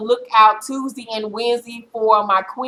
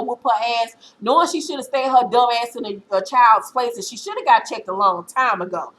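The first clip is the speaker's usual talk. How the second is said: ordinary delivery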